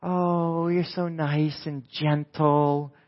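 mocking sweet voice. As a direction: coo it like a saccharine church lady, slowly. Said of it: "They think Christians are".

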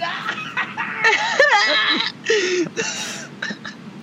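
long high delighted laugh. Real, not acted. Laughs at own idea.

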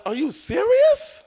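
high, drawn-out incredulous question; stretched, high-pitched protest; retold disbelief. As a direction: question God in stunned disbelief. Retelling shocked reply to God.

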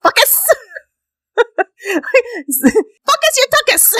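high mocking "Fuck ass", focus bent out of shape; teasing high-pitched "Fuck us, you tuckus"; mocking mispronunciation. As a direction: squeal "Fuck us" for focus through laughter. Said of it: "when you say focus, it sounds like fuck ass".

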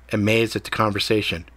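plain reading voice

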